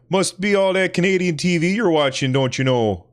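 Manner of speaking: high, bright Canadian accent with a lilting don't you know. Shown as a demonstration. Teasing himself about Canadian TV in a Canadian accent. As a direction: put on a thick Canadian or Wisconsin accent. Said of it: "if I'm gonna say that, I must be like".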